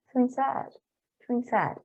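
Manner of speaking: ordinary talk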